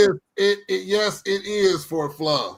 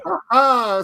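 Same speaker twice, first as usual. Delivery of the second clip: mocking laugh quote; 7 semitones higher, 5 dB louder than usual. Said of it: "Seth say".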